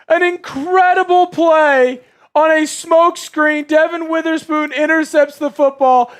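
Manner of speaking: high loud play call; excited play-by-play. Real, not acted.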